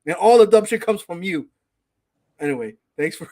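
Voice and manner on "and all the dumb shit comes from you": louder, higher heated rant; fired-up rant at the fans. Real, not acted. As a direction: vent the frustration hard. Ranting about rival fans.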